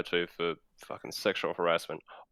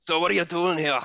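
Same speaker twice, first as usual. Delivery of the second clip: mock German accent; 10 semitones higher, 5 dB louder than usual. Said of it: "I'm gonna butcher a German accent".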